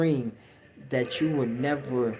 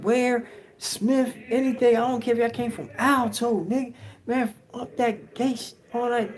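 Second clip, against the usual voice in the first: mock yelling; 11 semitones higher than usual. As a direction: rant at the whole dorm, picking a fight. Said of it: "and he's yelling".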